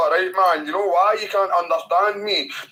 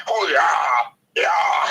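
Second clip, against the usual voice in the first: loud hammed-up moaning shout into laugh; mock orgasm cry; 3 dB louder, much slower than usual. Scottish guy mocking a Nebraskan woman, hammed-up loud cries.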